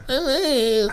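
put-on a toddler who can't decide, said high and slow. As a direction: drag out I don't know like a small child. Toddler answering.

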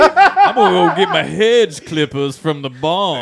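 slow, loud Southern drawl; southern accent. Pretend. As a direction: play a slow-talking Southern farmer. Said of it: "doing a southern accent".

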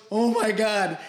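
high, loud amazed exclamation; mock amazement. Retelling a rival seeing him train at dawn, amazed. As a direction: play the rival stunned by your dedication.

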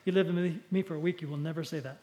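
ordinary talk, nothing marked

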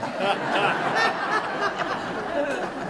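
bright high laugh after the punchline; amused laugh. Real, not acted. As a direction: laugh along with the congregation.

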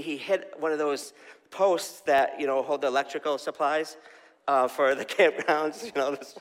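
ordinary delivery

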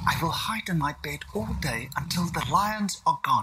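put-on a small child resolving to hide; worried little William. William hides in bed from lions, worried child voice.

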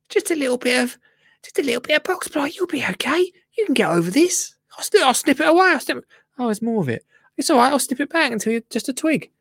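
high sing-song reassurance to the box hedge; comforting a plant. Pretend. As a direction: soothe it like a hurt puppy.

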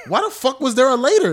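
loud, high-pitched disbelief; incredulous outburst. Real, not acted. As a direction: blurt it out, half laughing, totally baffled. Incredulous outburst at brother's story.

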